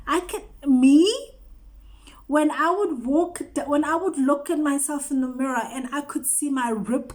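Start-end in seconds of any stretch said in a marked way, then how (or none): 0.1-1.1 incredulous. Real, not acted. Marvelling at own change.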